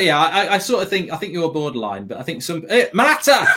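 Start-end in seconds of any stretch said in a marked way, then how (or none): 2.7-3.5 teasing shout. Real, not acted.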